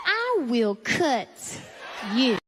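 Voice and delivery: slow, deadpan threat; deadpan threat when someone is too close. Acted. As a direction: drop the punchline slowly. Threat punchline.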